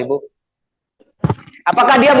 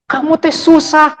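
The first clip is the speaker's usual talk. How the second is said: slow, high scolding of a child, half-played; mock angry mother; 8 semitones higher, 4 dB louder, slower than usual. A mother scolding her child in a temper, slow mock scolding. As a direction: scold the kid like a fed-up mom, hammed-up. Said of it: "Kalau udah bener-bener marah".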